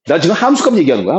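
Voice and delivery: ordinary talk, nothing marked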